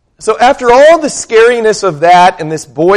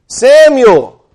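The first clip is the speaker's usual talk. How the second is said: loud, higher call of the name; 8 semitones higher, 5 dB louder than usual. Pretend. God calling the boy Samuel at night, loud and higher.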